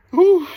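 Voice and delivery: loud high gasping whew from the cold; sharp whew. Real, not acted. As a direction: blow out a shocked whew. Gasp in icy plunge tank.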